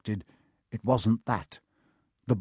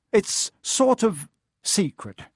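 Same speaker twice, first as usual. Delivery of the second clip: high, slow, halting admission; reluctant pupil dodging a question; uneasy, feeling out each word; nervous evasion; 8 semitones higher, slower than usual. A narrator's reading. Harry nervously evades the professor.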